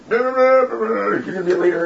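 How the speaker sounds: high, slow mock prophet; mock prophetic pronouncement. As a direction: proclaim it like an over-eager prophet. Imitates person giving prophetic word.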